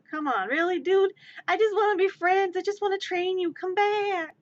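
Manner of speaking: high, whiny call after someone leaving; hammed-up dismayed plea; mock pleading. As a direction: beg it to return, loud and wailing. Calling after fleeing bird.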